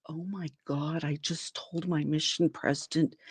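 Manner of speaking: appalled aside to herself; horrified murmur. Retold or imitated. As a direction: barely say it to yourself in shock. Horrified realization under her breath.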